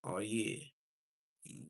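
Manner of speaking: ordinary talk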